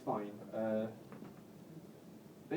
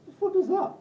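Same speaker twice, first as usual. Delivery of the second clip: high, louder laughing disbelief; incredulous laughing squeal; 7 dB louder than usual. Real, not acted. Comic mocking his own coffee habit, incredulous laughing squeal. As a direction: squeak out your own absurdity.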